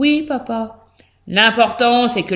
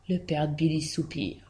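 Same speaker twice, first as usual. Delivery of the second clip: ordinary delivery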